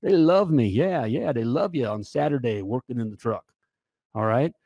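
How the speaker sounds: normal talking voice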